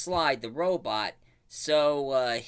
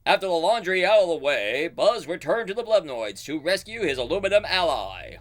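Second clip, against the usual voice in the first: louder announcer voice; dramatic narrator; 4 semitones higher, 5 dB louder than usual. Retold or imitated. Cartoon narrator moving the plot along, announcer style. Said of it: "the narrator says".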